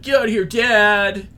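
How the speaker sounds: high, loud tantrum shout at his father; bratty yell. Retold or imitated. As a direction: scream it at your dad like a big baby. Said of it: "Admetus just yells at him".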